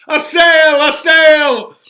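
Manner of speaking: teasing cry of "A sail"; mocking hail. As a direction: hail her like a ship coming in. Romeo mock-hails the nurse.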